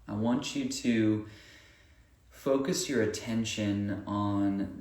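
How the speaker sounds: unhurried breathing guidance with long pauses; guided meditation. Acted. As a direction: lead them through each breath, leaving room between phrases.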